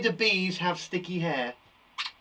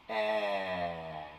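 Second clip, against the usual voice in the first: usual speaking voice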